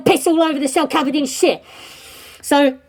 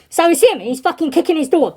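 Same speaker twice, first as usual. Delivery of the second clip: ordinary delivery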